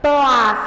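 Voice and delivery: "Plás" firme, com "s" esticado; sílaba tônica destacada. Shown as a demonstration. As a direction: solte "Plás" isolado, com ênfase. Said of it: "Olha aqui como eu falei". ("Plás" com força, som final alongado)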